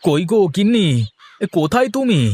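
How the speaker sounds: high-pitched holler for the wife; calling out loudly. Acted. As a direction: call through the house for someone. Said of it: "এমন ষাঁড়ের মতো চেঁচাচ্ছ কেন".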